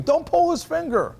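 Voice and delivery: high-pitched mock yell of alarmed parents; mock parents screaming. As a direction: squeal it like a panicked parent. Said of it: "like, screaming".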